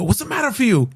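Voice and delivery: put-on sharp yelled scolding in a lightly done Korean accent; Master Joe's accent. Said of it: "that's my dad's talk".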